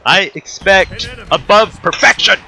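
hammed-up stern demand; mock demanding. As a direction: lay down the law like an exacting father. Mock demanding father answers his son.

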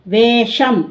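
normal talking voice

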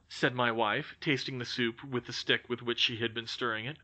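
ordinary delivery

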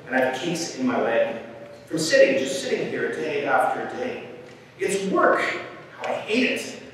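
mildly put-upon bird, complaining; lightly voiced bored bird; sighing lazy bird. A narrator's reading. Lazy bird grumbles about sitting on her egg, sighing complaint. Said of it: "sighed Maisie. A lazy bird".

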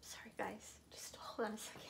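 soft, tearful whisper; whispered apology while crying. Real, not acted. Asking her viewers to wait, whispering through tears. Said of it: "I gotta quit crying here".

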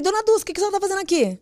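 high, rushed astonished question; surprised question. Retold or imitated. Woman startled to find her neighbour there, high surprised question. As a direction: re-enact blurting it out in surprise. Espanto ao encontrar a vizinha.

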